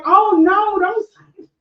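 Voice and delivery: high, loud, pleading stop; alarmed plea. Retold or imitated. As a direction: cut her off in a high-pitched panic. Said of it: "I was like".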